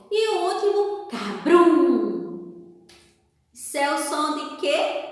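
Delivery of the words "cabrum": loud booming "cabrum"; big rumbling thunder sound effect; thunder imitation. Shown as a demonstration. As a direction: boom it out like a thunderclap. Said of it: "Do trovão".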